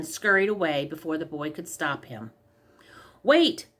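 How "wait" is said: sudden loud high call after someone; boy yelling after the fleeing man; shout. A narrator's reading. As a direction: call out to stop him. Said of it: "shouted the boy".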